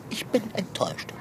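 disappointed whisper. Acted. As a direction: breathe it out, let down. Cat gives up, disappointed.

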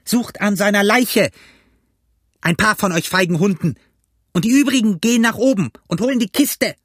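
high, scolding yell; angry ringleader's high-pitched call; cursing shouted orders. A narrator's reading. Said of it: "rief er".

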